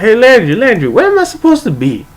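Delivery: high-pitched retold call for attention. Student calls to Landry to ask where to be, calling out. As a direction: re-enact calling after someone, pitched well up. Calling to a supposed friend from behind.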